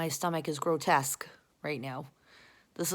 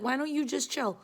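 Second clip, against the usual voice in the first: higher-pitched mock plea; exasperated plea; 7 semitones higher than usual. She can't understand people, higher exasperated plea. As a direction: plead it upward, exasperated.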